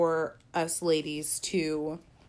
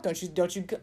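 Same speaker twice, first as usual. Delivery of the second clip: ordinary talk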